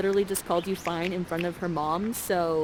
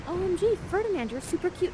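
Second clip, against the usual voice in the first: gushing Miranda; 9 semitones higher, faster than usual. Retold or imitated. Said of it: "Miranda's like".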